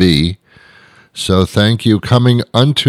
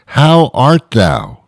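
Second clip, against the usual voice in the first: slow, drawn-out, higher pompous greeting; pompous Shakespearean; 4 semitones higher, much slower than usual. Acted. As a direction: stretch it out like a grand Elizabethan. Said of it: "I think they talk like that".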